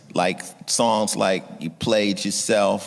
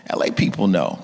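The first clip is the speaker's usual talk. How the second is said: usual speaking voice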